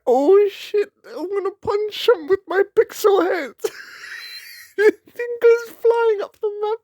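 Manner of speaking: very high, wheezy squeal through laughter; laughing to tears. Real, not acted. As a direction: keep joking while helpless with real laughter. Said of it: "I'm smiling and I'm crying".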